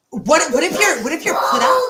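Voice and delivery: words broken by real laughter; laughing. Real, not acted. Laughter heard on lines.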